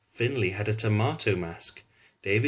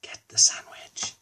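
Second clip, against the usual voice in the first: whisper. A narrator's reading. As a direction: breathe it to the fish. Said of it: "whispered Finley".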